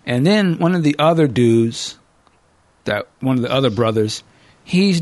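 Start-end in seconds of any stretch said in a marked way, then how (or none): none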